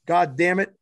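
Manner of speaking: usual speaking voice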